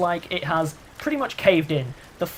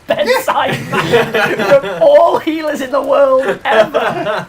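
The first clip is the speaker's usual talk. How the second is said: laughing; 8 semitones higher, 12 dB louder, slower than usual. Real, not acted. Laughing comment.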